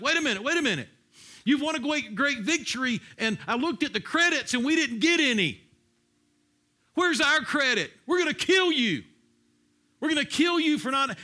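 high, loud aggrieved outburst; indignant protest. Acted. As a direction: protest like a cheated mob threatening revenge. Acting out jealous Ephraimites' protest.

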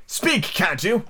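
high, insistent demand; impatient cry. A narrator's reading. Heiga pressing silent Hatta to talk, impatient high cry. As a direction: urge him sharply to answer. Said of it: "Heiga cried impatiently".

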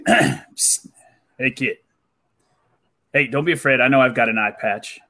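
low, secretive stranger voice; lightly voiced shady agent; mysterious recruiter.

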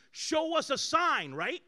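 scornful shouted challenge rising in pitch; demanding Pharisees. Retold or imitated. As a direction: taunt Jesus for a sign. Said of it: "The Pharisees always said to Christ".

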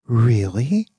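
mildly puzzled, questioning lift; a touch of curious doubt; quizzical. A narrator's reading. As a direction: ask it as if unsure you're being teased. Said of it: "Asked Virgil quizzically".

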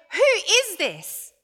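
high, loud burst of amazement; amazed question. Acted. Disciples after Jesus calms the storm, amazed question.

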